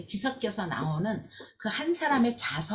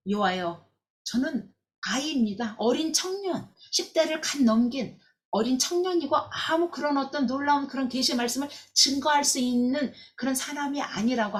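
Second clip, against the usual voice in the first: put-on higher, pleading young man's refusal; young Jeremiah protesting; 6 semitones higher than usual. Jeremiah refusing God's call, higher pleading protest. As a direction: plead that you're only a boy, pitched up. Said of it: "예레미야가 완강히 거부해요".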